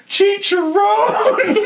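high, delighted shriek of joy; a little girl shrieking over pork rinds; excited squeal. Retold or imitated. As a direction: squeal it like finding treasure. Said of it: "She's like".

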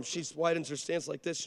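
ordinary talk, nothing marked

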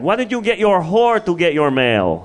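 high-pitched mock jealous Russian woman; jealous Russian ex-girlfriend. Jealous ex confronts him about his new girlfriend, high mock accusation. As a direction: raise the pitch, imitating an accusing ex. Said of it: "And she's like".

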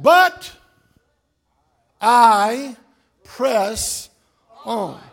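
slow, swelling to a loud peak on but I; scripture read building to a roar; building preaching. Acted. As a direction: preach the verse up to a shout.

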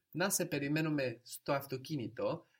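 Να σε περιμένουμε στο αυτοκίνητο as a question, ending on a rise; question intonation. Shown as a demonstration. Teacher showing the student how to ask it, question intonation. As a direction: make Να σε περιμένουμε στο αυτοκίνητο sound like asking, tune up at the end. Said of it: "we must make a question intonation".

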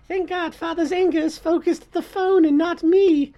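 put-on high, meek child voice; whisper-thin relief of a scared kid; timid child. Voicing the family child.

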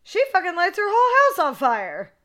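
high, loud burst of amazement; excited exclamation. Real, not acted. Fan telling how Laurie burns her house, thrilled. Exclaims at climax of retold film.

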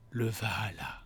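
dreamy low whisper. Acted. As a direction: whisper it like a vision. Reads the review dramatically.

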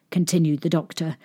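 plain reading voice

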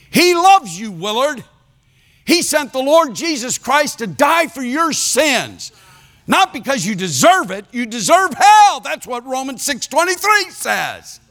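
high, driving preacher's voice, punching each verse; full-force preaching. Retold or imitated. Preacher hammering scripture at Willard, high and forceful. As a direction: hammer the scripture home at him.

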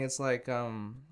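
normal talking voice

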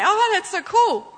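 amazed prophet. Retold or imitated. Prophet amazed at his own words, gushing. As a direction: gush like a thrilled kid. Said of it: "he'll say".

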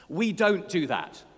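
loud, slow rebuke from a French schoolmaster; stern French teacher. Acted. French teacher telling off a pupil, stern and loud. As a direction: lay down the school rule loudly and slowly. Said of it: "it's terrible French cod accent".

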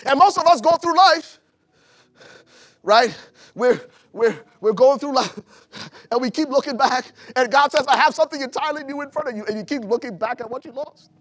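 high, loud preaching; full-force preaching. Acted. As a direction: shout it to the back row. Preaching build.

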